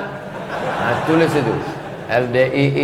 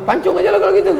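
laughing reversal; much faster than usual. Retold or imitated. The family hearing the blood money price and laughing it off. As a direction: laugh it out in mock shock.

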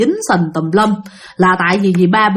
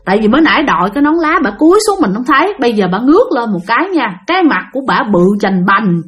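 ordinary delivery

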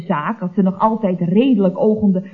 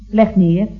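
ordinary talk

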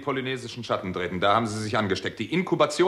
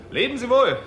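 loud farewell; 12 semitones higher, 6 dB louder than usual. Acted. Farewell after punching Al.

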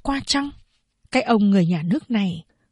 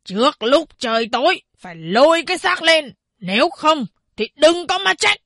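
higher, official ultimatum; legalistic tone; 6 semitones higher than usual. A narrator's reading. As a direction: lay down the law. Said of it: "nói giọng pháp luật".

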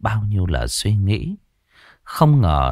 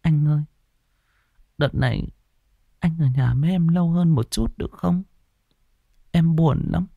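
whimpering wife; 9 semitones higher than usual. A narrator's reading. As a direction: beg your husband to stay, close to tears. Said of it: "mếu máo". (người vợ mếu máo xin chồng ở lại, giọng cao nhỏ)

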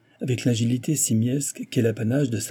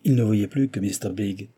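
usual speaking voice

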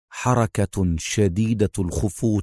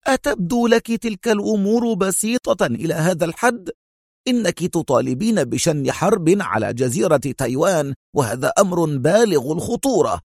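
higher-pitched, incredulous challenge; stern objection; 8 semitones higher than usual. A narrator's reading. Consul objecting to Mona's plan for war, sternly. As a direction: object gravely, voice raised in pitch. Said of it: "ازداد انعقاد حاجبيه في حدة وهو يقول".

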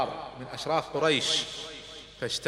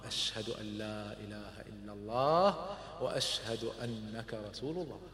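solemn testimony; 5 semitones lower, 8 dB quieter than usual. Acted. Umar's solemn shahada.

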